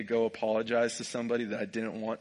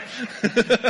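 a loud, high burst of laughter; 12 semitones higher, 6 dB louder than usual. Real, not acted. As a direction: let out a squeal of laughter. Joking about doxing the teacher.

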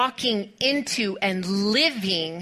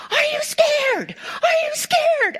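top-of-lungs yell; slower than usual. Retold or imitated. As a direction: bellow the question at the scared kid. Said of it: "yelling at them at the top of his lungs".

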